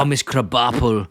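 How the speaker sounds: slow, lightly put-on swooning chef; a hint of a smitten chef, drawn out; lightly voiced fugu chef. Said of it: "but he calls her Miss Krabappel".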